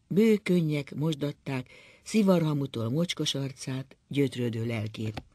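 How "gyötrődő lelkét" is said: ordinary delivery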